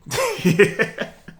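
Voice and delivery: high playful cackle like a cartoon villain; mock villain cackle. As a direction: laugh like a villain plotting, tongue in cheek. Joking about saving the world.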